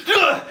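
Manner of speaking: sharp jolted grunt; startled grunt. Retold or imitated. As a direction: grunt like falling in a dream. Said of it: "you're just like".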